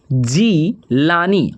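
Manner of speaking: Jilani stretched slow, syllable by syllable; slow dictation of Jilani; drawn out slowly. Shown as a demonstration. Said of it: "और जीलानी का हो".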